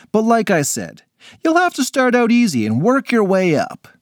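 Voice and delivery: lightly voiced hearty coach; booming friendly trainer; gym instructor.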